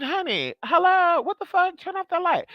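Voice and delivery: exasperated yell. Retold or imitated. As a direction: yell at him to kill the light. Woken at 3am, friend in chair.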